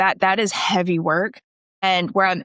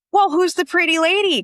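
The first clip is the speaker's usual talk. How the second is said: much higher, playful compliment; pleasantly surprised, pitched way up; imitated admiring surprise; 9 semitones higher than usual. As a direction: act out a grandfather's delighted double take. Voicing grandpa's admiring greeting.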